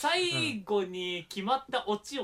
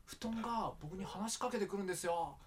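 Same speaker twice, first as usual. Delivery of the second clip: hammed-up whimsical anecdote, higher; light mock comic narration, voice raised; put-on storyteller; 7 semitones higher, 6 dB louder than usual. Shown as a demonstration. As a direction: tell it like a forced funny bit. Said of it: "もう作ってんの".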